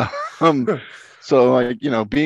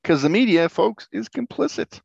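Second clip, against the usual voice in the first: mock pundit; 10 semitones higher, 3 dB louder than usual. Mocking a pundit.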